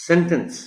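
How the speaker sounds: sentence as SEN-tence, first syllable high; stress on first syllable. Shown as a demonstration. Teacher models the noun, sentence stressed on SEN. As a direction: punch SEN in sentence, let the end fall. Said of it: "primary stress ایس پر ہے".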